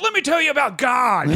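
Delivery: a hint of a lofty preacher; lightly pompous mock; mock preachy comic. Mocking a preachy young comic, lightly pompous.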